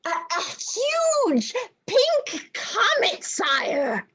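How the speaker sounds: high, breathless excited voice; excited young astronomer. Acted. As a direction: blurt the news high and eager.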